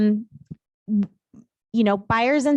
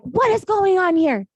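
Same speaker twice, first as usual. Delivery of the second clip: mock demanding buyer's agent; 8 semitones higher than usual. Buyer's agent confronting her over a photo, high mock demand. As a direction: demand answers like the upset agent.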